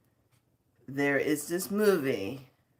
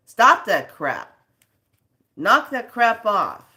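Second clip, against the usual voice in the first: slow, loud, hammered scolding; fed-up lecture at the viewers; angry scolding; 5 semitones higher, 8 dB louder, slower than usual. Real, not acted. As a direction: lay down the law, word by word. Ranting at clickbait posters.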